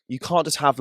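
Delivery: ordinary delivery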